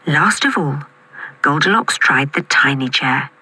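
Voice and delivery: usual speaking voice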